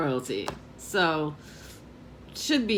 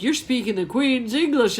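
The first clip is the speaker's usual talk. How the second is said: higher, mildly alarmed retelling; mock concern, pitched up; worried; 6 semitones higher, 4 dB louder than usual. Man warning a tourist about speech, mock worried. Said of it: "he's really worried about it".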